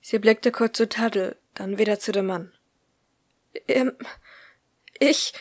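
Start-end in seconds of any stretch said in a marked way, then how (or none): 3.7-5.3 faint, higher, halting whisper; words failing her in shock; stammering. A narrator's reading. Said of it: "stammelte sie".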